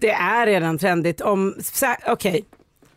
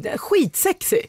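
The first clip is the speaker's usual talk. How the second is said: much higher, gushing praise; excited; 10 semitones higher than usual. Real, not acted. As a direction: swoon over the accent. Hyllar cockney-dialekten. (hänfört, mycket högre, förtjust)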